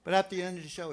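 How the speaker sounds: ordinary delivery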